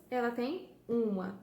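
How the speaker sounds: usual speaking voice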